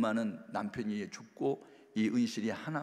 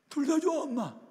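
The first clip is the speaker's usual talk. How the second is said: much higher and louder, crying out; the daughter wailing under the blows; desperate cry; 6 dB louder than usual. Retold or imitated. Daughter cries out to her beating mother, high desperate wail. Girl repeating answer while beaten.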